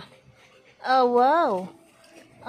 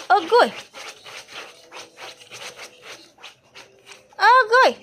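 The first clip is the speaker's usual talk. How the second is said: loud exclamation climbing higher; high-pitched yelping; 12 semitones higher, 5 dB louder than usual. Real, not acted. Vlogger exclaiming at the pets, high loud yelps. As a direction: yelp it louder and far higher.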